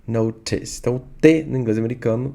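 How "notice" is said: British "notice", crisp true T; British accent. Shown as a demonstration. Teacher repeating the actress's way, British "notice". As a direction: pronounce the T in "notice" as a real T, British-style. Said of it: "por ser britânica, ela vai falar".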